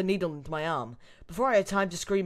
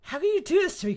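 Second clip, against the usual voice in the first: high, loud melodramatic protest; betrayed outrage; 13 semitones higher, 7 dB louder than usual. Acted. Captive protesting to Krauser, high-pitched and hurt. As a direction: cry out at a betrayal.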